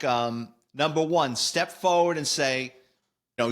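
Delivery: normal talking voice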